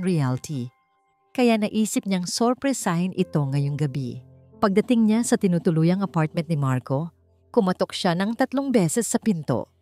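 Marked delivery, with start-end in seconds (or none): none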